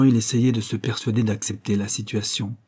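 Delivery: ordinary delivery, nothing marked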